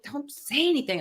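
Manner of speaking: a hint of stern warning; mild mock scolding. Manager scolding him, mild mock warning. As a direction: warn him off, lightly. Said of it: "he's scolding me".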